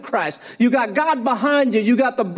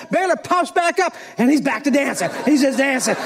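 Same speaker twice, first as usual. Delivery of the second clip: ordinary delivery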